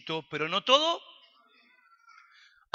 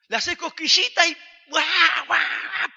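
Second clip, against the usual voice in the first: high, loud mock crying through the line; imitated baby wail; 10 semitones higher, 9 dB louder, slower than usual. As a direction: whine it like an unhappy baby. Baby crying despite tickling.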